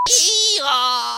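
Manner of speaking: loud braying donkey call. Real, not acted. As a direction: bray like a donkey. Donkey noise pun.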